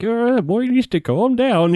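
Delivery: put-on sweet old grandma praising a shot. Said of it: "going to do a grandma voice".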